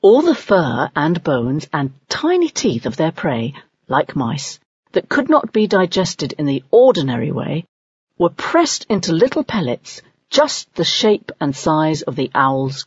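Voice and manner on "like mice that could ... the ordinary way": usual speaking voice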